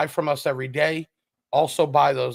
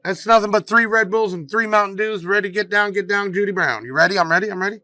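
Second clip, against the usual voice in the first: loud, high hyped-up yelling; all caffeine and adrenaline, yell it; 6 semitones higher, 7 dB louder, faster than usual. Real, not acted. Hyped up on energy drinks.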